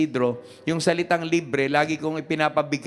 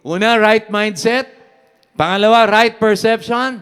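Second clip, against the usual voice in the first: emphatic listing; 4 semitones higher, 7 dB louder than usual. Acted. Announces points loudly.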